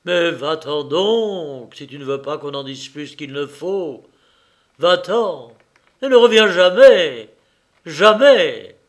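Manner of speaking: shouting him out of the house for good; furious shouting. A narrator's reading. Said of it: "bégayant des gros mots".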